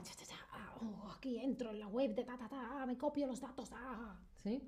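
quiet secretive hacker whisper; whispered sneaky hacker. Pretend. Plays a hacker stealing data.

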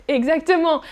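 ordinary delivery

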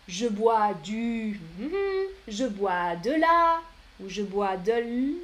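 slow pattern drill, each option spelled out; slow model sentences. Shown as a demonstration. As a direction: read the three options slowly, like a teacher. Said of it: "Écrivez votre boisson". (lentement, comme une enseignante qui montre le modèle)

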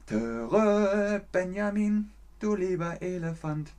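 sing-song a children's cartoon intro; a hint of the cartoon elephant theme; Benjamin Blümchen. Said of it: "Der hat Benjamin Blümchen gesprochen".

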